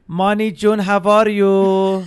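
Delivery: loud, high, stretched-out motherly greeting; doting mom impression. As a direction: call out slowly like an overjoyed mom. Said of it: "and she's like".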